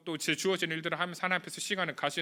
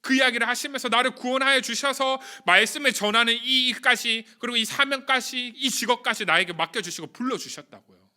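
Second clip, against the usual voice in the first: louder, higher impassioned preaching; preaching at full force; 5 semitones higher, 6 dB louder than usual. Acted. Pastor exhorting the youth group, rising fervent preaching. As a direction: build the sermon to its fervent peak.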